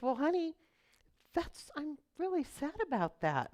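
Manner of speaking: high, gentle imitation of a sweet mom; sweet mother. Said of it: "looked at me very sweetly".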